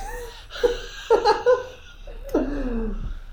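long high-pitched laugh. Real, not acted. Laughs at joke.

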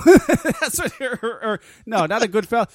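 laughing through the correction; giggling self-correction. Real, not acted. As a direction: stumble over it while cracking up.

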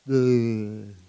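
low, soft tired groan; the soul's tired groan while the devil pounds. Retold or imitated. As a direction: groan like a weary soul. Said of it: "your soul's going".

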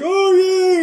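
high, loud gleeful squeal; excited squeal. Retold or imitated. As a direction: squeal with toy-dinosaur joy. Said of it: "he's like".

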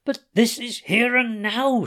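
high, loud scream. Acted. O'Keefe screaming at Timothy over the gun, loud. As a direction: scream it at him. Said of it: "screamed O'Keefe".

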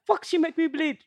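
high, loud mock Virgin Islands accent. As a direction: imitate a Virgin Islands woman crying out. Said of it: "remember Carla Chill from the Virgin Islands".

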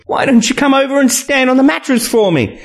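high, sarcastic suggestion; sarcastic female character. A narrator's reading. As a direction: ask with sarcasm, pitched far up. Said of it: "she says sarcastically".

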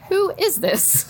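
high-pitched mock incredulity; acted bewilderment. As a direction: pitch it way up, comically baffled. Retelling puzzlement at stranger's texts.

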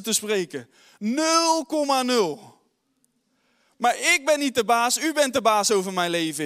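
loud, driving sermon voice, pressing every line; full-force preaching. Acted. Preacher urging the church toward revival, full-force preaching. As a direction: preach it out to the whole hall.

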